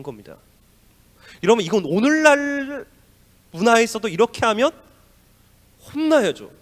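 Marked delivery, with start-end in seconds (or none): 1.4-6.5 preacher stresses to the church, loud and high; emphatic preaching. Real, not acted. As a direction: hit it hard for the congregation. Preacher exclaiming to congregation.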